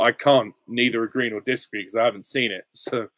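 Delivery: ordinary delivery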